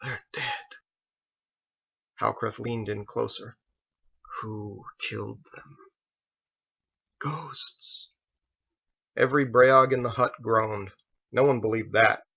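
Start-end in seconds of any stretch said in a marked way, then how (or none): 0.0-0.6 feverish whisper. A narrator's reading. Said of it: "breathy, feverish whisper". 4.4-5.8 quiet menace. A narrator's reading. Leaning in to question. 7.2-8.1 breathy unvoiced whisper, higher; feverish whisper. A narrator's reading. Dying captive whispers an answer to Halkrith, breathy and feverish. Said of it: "breathy, feverish whisper".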